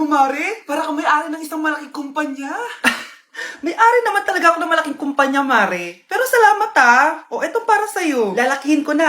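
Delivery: normal talking voice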